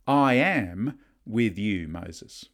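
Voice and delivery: slow, weighty stress on "I am". Acted. God reassuring Moses, "I am" said emphatically. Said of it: "God is more emphatic with".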